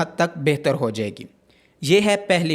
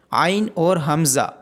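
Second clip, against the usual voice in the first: ordinary talk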